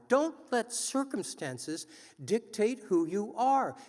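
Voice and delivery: rousing speech to a crowd; podium speech. Acted. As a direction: urge the audience to act. Rousing call to action.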